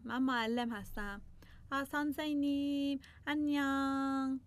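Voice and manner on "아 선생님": ordinary delivery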